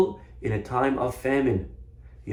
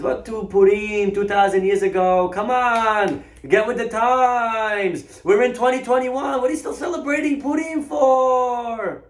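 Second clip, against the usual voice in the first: high, stretched-out mock incredulity; mocking exclamation; 11 semitones higher, 4 dB louder, slower than usual. Rabbi throws logic back at shopkeeper, loudly mocking. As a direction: belt it out slowly, dragging the phrase ends.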